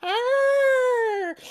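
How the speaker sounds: loud falsetto bear roar; long, high mock growl; high-pitched arr. Mama Bear's roar.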